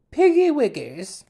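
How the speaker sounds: squeaky high cooing voice; silly high baby voice. Retold or imitated. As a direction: coo it like a doting teacher. Teacher's pet name for guinea pigs.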